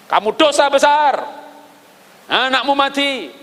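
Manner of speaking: high, slow stern rebuke of a prophet; prophet's rebuke. Retold or imitated. Prophet Nathan condemning David for his sin, slow stern rebuke. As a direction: pronounce judgement slowly from above.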